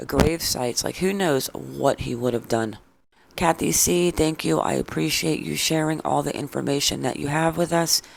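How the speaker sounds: usual speaking voice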